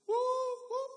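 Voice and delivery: high hooting train whistle imitation. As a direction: toot it like a distant train. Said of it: "hears a whistle".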